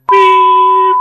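imitated PA beep. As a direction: mimic a loud chime before the announcement. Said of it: "there is a sudden just, like".

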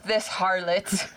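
slow, theatrical olden-times outrage; drawn-out, hammy period-drama voice; mock archaic indignation. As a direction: play an offended spirit from centuries ago. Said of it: "I was like".

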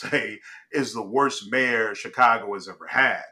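ordinary talk, nothing marked

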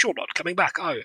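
soft, put-on English accent; terribly English accent. Repeating the woman's question, softly mock-English. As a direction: lightly mimic English reserve, dropping the volume. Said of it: "I'm terribly English".

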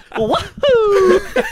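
loud, very high falsetto whoop; Charles Martinet's Mario. Retold or imitated. Imitating the voice actor turning back to the fan, high Mario whoop. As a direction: burst back into the cartoon mascot cry. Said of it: "And then turns around to me".

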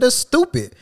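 high-pitched, exasperated dismissal; scornful retort. Retold or imitated. Worker dismisses boss's complaint, retold scornful outburst.

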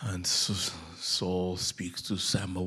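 ordinary delivery

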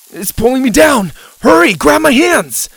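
high, loud panic; panicked shout. A narrator's reading. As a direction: scream for help. Dylan being sucked down.

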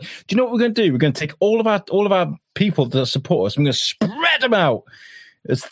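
high, smug mock strategist; mocking the planner. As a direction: pitch it high like a proud fool.

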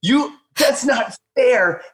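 high, whiny mock protest at his wife; mock exasperation. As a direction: play up the outrage for laughs. Mock-scolding wife over phone.

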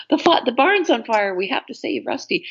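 high, hammed-up TV rescue panic; mock dramatic alarm. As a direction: play the corny kids'-show emergency. Acting out a Littlest Hobo plot.

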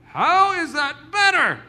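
slow, high, loud protest; incredulous question. Retold or imitated. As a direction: demand an answer in disbelief. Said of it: "all of us in here going".

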